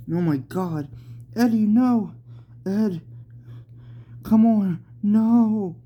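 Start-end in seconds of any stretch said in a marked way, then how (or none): 1.4-5.8 high, loud desperate pleading; begging his friend not to go, voice rising loud; crying out. Acted. Jeremy pleads with Eddie, crying out loud and high. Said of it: "As Jeremy cried".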